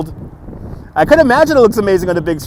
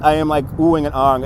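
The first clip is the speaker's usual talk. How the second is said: normal talking voice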